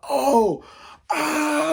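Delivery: high, sharp cry of pain; mock scream as the toes throb; replaying the moment he screamed; retold pain scream. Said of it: "I'm just screaming".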